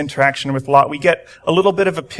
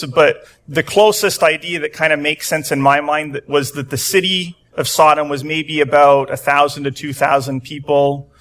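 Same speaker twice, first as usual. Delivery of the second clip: ordinary delivery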